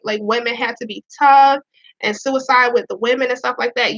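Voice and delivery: ordinary talk, nothing marked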